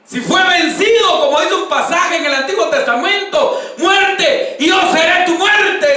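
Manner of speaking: loud, high, drawn-out proclamation; triumphant preaching shout. Real, not acted. Preacher defying death before the congregation, triumphant shout. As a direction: cry it out to the whole congregation.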